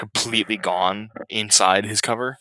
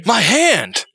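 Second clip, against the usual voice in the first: high, loud hammed-up cry; weird line reading; 12 semitones higher, 5 dB louder than usual. Quoting the game villain's severed-hand line, weird high-pitched cry. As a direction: yelp it out in mock agony. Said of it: "one of the weirdest line readings".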